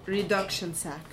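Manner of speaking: put-on a slight Russian accent on the words; thick Russian accent. A narrator's reading. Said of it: "she says in her thick Russian accent".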